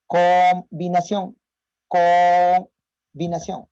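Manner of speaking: combinación stretched slow and loud; mixed stutter demo. Shown as a demonstration. Teacher demonstrating a mixed stutter, slow and prolonged. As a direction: hold the sounds of combinación, very slow. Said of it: "Combinación con los espasmos".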